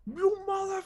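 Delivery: muffled angry shout. Acted. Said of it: "you kinda hear someone angry".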